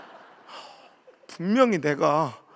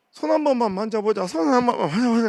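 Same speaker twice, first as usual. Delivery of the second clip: put-on eager old women begging, a bit louder; pleading grannies; 5 semitones higher, 6 dB louder than usual. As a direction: mimic grannies clamoring to touch. Grannies begging to touch hand. (손 만지자고 조르는 할머니 흉내)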